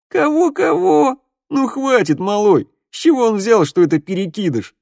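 put-on high, loud boyish jeering; loud mocking disbelief. A narrator's reading. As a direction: scoff at the little one's tall tale. Boys scoff at Gelu's claim.

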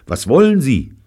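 high-pitched, loud startled question; stammering. A narrator's reading. David to the wild-looking intruders, stammering in fright. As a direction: blurt it out high and loud, rattled. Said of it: "stammelte David".

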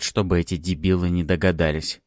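ordinary delivery, nothing marked

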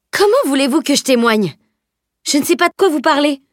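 high, baffled retort; exasperated protest. A narrator's reading. Girl asked to witness an unknown feat, exasperated protest. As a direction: object to an absurd request.

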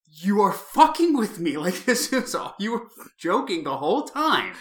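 high-pitched, indignant mock accusation; incredulous outburst. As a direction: re-enact accusing someone of a prank. Acted outburst of disbelief at wife.

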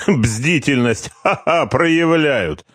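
slow, laughing mockery; mocking laugh. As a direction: laugh at their vigilance. Corporal laughs mocking sentries.

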